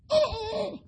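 high-pitched, drawn-out "oh!"; exaggerated hurt wail; mock wounded cry. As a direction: cry out as if cut to the heart, hammed up. Mock reaction to husband's hurtful refusal.

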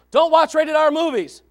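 loud, high admonishment; stern order. Acted. Youth pastor warning the kids, stern and loud. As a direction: lay down the rule forcefully.

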